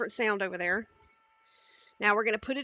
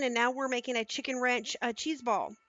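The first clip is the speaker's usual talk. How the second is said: ordinary talk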